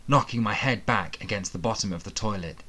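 plain reading voice

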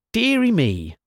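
a mock-fussy crone's pipe; piped. A narrator's reading. Wise woman mocking the grand gentlemen, piping high. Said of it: "piped the wise woman".

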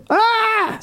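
sudden high-pitched yelp of panic; a sharp shriek; anxious shriek. Retold or imitated. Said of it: "I was like".

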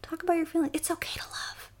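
soft high whisper, gentle and slow; hushed mock comfort; mock therapist. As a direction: coo it like a soothing therapist. Mock therapist soothing listeners.

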